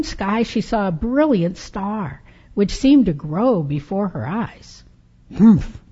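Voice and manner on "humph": short loud grumpy humph. Acted. Old Befana closing her shutter, grumpy loud humph. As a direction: scoff at the star with a huff.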